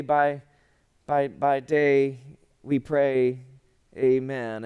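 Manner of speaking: halting mock prayer, groping for words; fumbling prayer. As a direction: stall and restart as if making it up. Fumbled prayer by nervous person.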